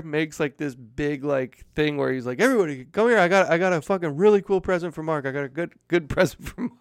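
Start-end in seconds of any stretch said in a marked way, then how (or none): 2.4-6.7 high, loud announcement; loud, excited call that eases off toward the end; calling everyone over. Retold or imitated. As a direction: imitate a brother rallying the room. Brother calls everybody over, acted.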